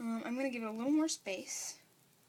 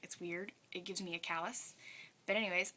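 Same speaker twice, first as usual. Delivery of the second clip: ordinary delivery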